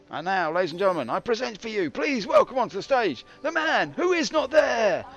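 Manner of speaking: loud, high, building showman's introduction; grand hammed-up ringside announcement; mock stage announcer. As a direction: bellow it like an MC, stretching the final words.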